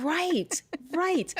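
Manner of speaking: mock Australian twang. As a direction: echo her Aussie twang back to her, teasing. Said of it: "I hear it sometimes, and I go".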